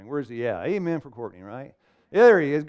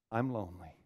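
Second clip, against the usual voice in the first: quoted lonely; 6 semitones lower, 12 dB quieter than usual. Retold or imitated. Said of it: "cause of quitting was".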